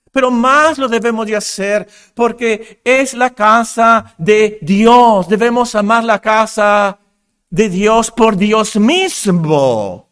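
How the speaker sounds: emphatic, high-energy sermon climax; forceful preaching. Real, not acted. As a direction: drive it home to the congregation, stretching the last words.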